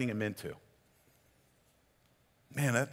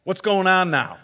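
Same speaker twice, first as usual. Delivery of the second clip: provoking call; 6 semitones higher, 8 dB louder than usual. Retold or imitated. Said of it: "Jesus is there saying".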